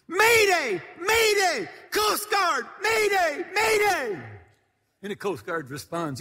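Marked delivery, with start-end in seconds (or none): 0.1-4.2 high, loud panicked distress call; frantic mayday shout. Retold or imitated. Captain radioing the Coast Guard, panicked high shout. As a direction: yell it into the radio like the boat is sinking. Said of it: "and he said".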